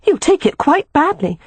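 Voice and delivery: high-pitched, emphatic woman's voice. A narrator's reading. Sister warns brother about her husband, emphatic. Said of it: "she said emphatically".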